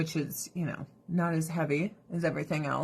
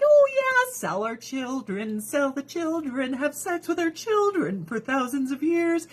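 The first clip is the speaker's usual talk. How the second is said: much higher, cheery mock parents; 6 semitones higher than usual. Mocking parents selling children, high cheery voice. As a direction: play them eager and careless. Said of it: "the parents are like".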